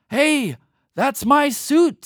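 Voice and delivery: high, loud, slow indignant cry; outraged moose. Acted. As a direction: yell it like a robbed moose. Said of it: "said Moose".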